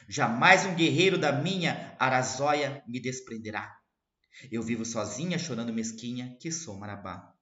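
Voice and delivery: declaiming verse; poem recital. Acted. Teacher reciting to students, declaimed. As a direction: read it out as poetry.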